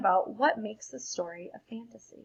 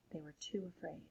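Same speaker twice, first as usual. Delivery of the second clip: spooky whisper; 2 semitones lower, 12 dB quieter than usual. Acted. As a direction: hush the class with a scary secret. Spooky ending line of story.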